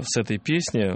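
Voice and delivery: normal talking voice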